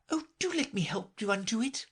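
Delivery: put-on anxious helpful girl. A narrator's reading. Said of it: "looking anxiously about".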